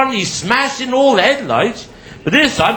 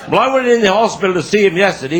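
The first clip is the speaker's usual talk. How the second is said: usual speaking voice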